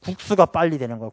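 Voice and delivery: normal talking voice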